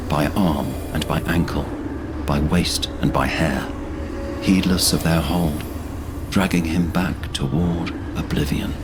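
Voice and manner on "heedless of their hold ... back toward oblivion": normal talking voice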